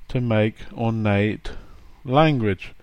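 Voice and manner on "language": ordinary delivery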